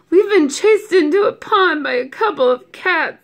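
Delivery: high, loud embarrassed whine; moaning complaint. A narrator's reading. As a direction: groan it out in mock misery. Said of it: "they moaned".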